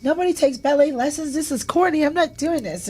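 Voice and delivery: put-on high, whiny little-kid protest; pouting child complaining; bratty kid voice. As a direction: play your four-year-old self refusing ballet. Child self protesting ballet lessons.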